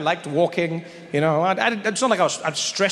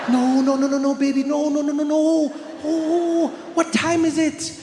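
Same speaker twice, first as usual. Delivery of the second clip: mom realizing she forgot her son, high and frantic; panicking mom; 11 semitones higher than usual. Retold or imitated. Mom to her son walking in late, high frantic panic.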